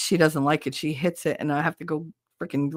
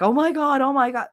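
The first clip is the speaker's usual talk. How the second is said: high, louder flustered outcry; hammed-up panic, pitched up; mock panic; 8 semitones higher, 4 dB louder than usual. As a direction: act out a panicking bystander. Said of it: "if you panic and get like".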